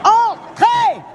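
high shouted Entrez; calling out. Real, not acted. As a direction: shout Entrez across the room. Calling someone to the door.

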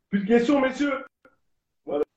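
high, loud call to the room; mock announcement. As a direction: call it out like closing a press conference. Coupe court, façon conférence de presse. (annonce lancée haut et fort)

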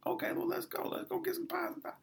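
excited high squeal. Retold or imitated. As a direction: squeal it like a kid who just heard dessert. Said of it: "And I'm like".

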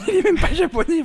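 very high, loud squeal with laughter; outraged protest. Acted. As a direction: shriek the objection, half laughing. Ivrogne proteste en riant. (protestation suraiguë, en riant)

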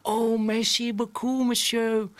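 French words in a put-on English accent. Old English lady thanking Fred at the cinema door, in an English accent. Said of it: "Avec un, un superbe accent anglais".

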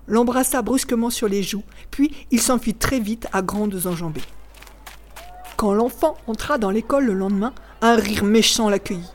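usual speaking voice

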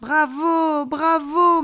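high, loud, drawn cheers; congratulating. A narrator's reading. Alien child applauds friend's first flight, congratulating. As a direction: cheer your friend's success. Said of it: "me félicita-t-il en m'applaudissant".

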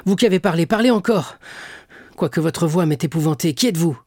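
high, loud pleading call; urgent cry. A narrator's reading. Dantès begging the hidden voice, loud plea. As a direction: beg the voice to speak again. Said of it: "s'écria Dantès".

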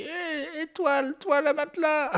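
high, far-off creaky grandpa voice with a laugh; old grandpa voice. Retold or imitated. Grandpa blurting a nonsense line to someone. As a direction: play a doddering grandfather mumbling. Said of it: "c'est un grand-père qui dit".

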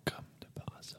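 sly ironic whisper. Acted. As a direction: whisper it knowingly.

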